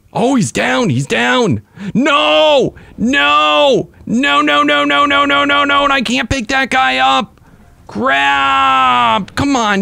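high-pitched frantic wailing; panicked outcry. Real, not acted. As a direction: cry out in dismay as it all goes wrong. Frantic as creature goes down.

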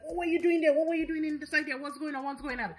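retelling a man losing it, only lightly acted; mild frantic demand. Dongjae losing his mind at the pair from the room, mock frantic questioning. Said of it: "Dongjae loses his mind".

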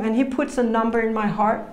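ordinary delivery

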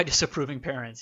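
usual speaking voice

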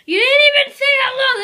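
high, loud scolding. Real, not acted. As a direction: tell him off for a bad take. Scolding partner's bad take.

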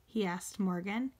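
ordinary delivery, nothing marked

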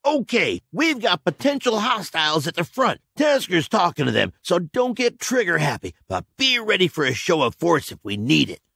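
brief the squad crisply; commander briefing. A narrator's reading.

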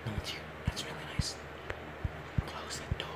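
hushed whisper while hiding. Real, not acted. Kid hiding in the game whispers to stay unheard. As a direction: breathe it so nobody hears.

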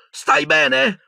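very high, urgent call; anxious yelled question; shouted. Acted. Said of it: "urlò".

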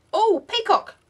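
high, loud squeal of delight; light up at the find. Real, not acted. Opening [name]'s swap gifts, thrilled squeal at a peacock.

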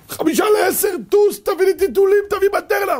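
high shouted orders; urgent shout. Retold or imitated. Wife ordering husband to rush to shop, urgent high shout. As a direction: bark the errand at him in a hurry.

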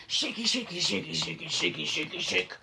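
ordinary delivery, nothing marked

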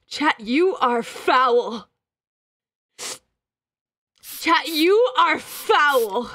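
very slow, drawn-out condemnation; appalled, heavily drawn-out rebuke; disgusted scolding. Real, not acted. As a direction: stretch each word in revulsion. Scolding chat for farting.

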